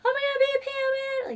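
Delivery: mock fangirl shriek, very high and loud; hammed-up high-pitched screaming; crazy fangirl. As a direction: squeal it like a hysterical fan. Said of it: "can you imagine me by myself running to them".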